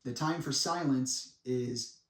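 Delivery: ordinary talk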